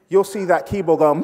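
usual speaking voice

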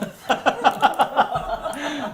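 high, bursting laugh; cackling laugh. Real, not acted. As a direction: break into laughter. Laughs at his own joke.

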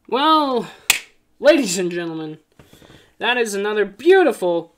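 loud, high hype host voice; announcer outro. Acted. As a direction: pump up the outro.

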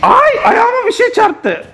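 high and slow, laughing as the car hits the foot; startled laugh. Real, not acted. Dad startled as the toy car hits his foot, laughing. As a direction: yelp it with a laugh.

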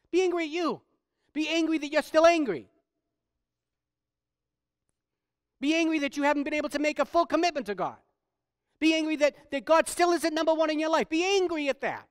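high, loud preaching push; forceful exhortation. Real, not acted. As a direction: drive it home urgently, pitch held high. Preacher urging listeners with force.

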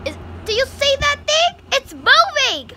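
high, loud frightened scream; screaming at a lizard. Real, not acted. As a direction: shriek it out in real fright. Spots the lizard moving.

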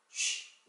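쉬 as a long breathy unvoiced sh; 쉬 said as a soft voiceless sh; sh sound demo. Shown as a demonstration. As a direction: hiss out 쉬 with no voice. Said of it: "쉬 이런 소리가 나요".

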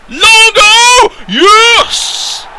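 very high, loud scream of joy; jubilant shout. Real, not acted. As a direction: yell the scorer's name in triumph. Celebrating a goal.

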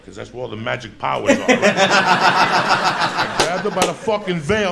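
ordinary delivery

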